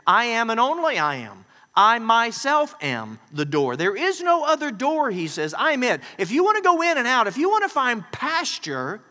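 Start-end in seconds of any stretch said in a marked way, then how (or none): 0.1-5.1 loud, forceful, every word driven home; emphatic. Acted. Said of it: "that's an emphatic statement". 5.5-9.0 loud, forceful, every word driven home; emphatic. Acted. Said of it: "that's an emphatic statement".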